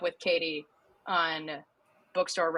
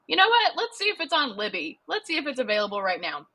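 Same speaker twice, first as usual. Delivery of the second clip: excited; 7 semitones higher, faster than usual. Retold or imitated. Excited self-quote about rereading.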